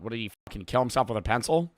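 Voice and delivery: ordinary delivery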